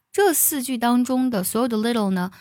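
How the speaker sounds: ordinary talk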